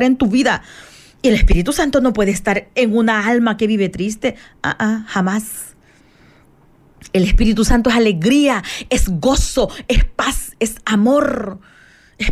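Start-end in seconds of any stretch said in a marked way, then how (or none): none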